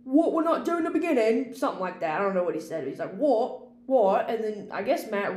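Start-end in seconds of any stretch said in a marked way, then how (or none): 0.0-1.5 higher, louder mock British accent; Robert Pattinson. Actor questioning the director mid fight scene, imitated British accent. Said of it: "he's talking in his British accent". 3.2-4.3 loud mock British "what"; Robert Pattinson. Imitating an actor confused on set, loud British accent. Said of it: "he's talking in his British accent".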